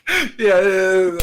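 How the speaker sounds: high startled yeah; jolted by a sudden snap; startled yelp. Real, not acted. Headphones just shocked him.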